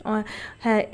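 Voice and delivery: ordinary talk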